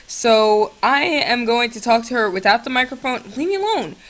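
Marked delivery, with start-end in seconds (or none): 3.4-4.0 high, whiny leave me alone; high whiny brush-off; shooing off. Real, not acted. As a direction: shoo them off in a high whine. Shooing someone away, high.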